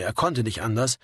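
ordinary delivery, nothing marked

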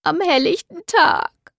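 high, slow, tearful outrage; sniffling indignantly. Acted. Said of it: "schniefte Doro empört".

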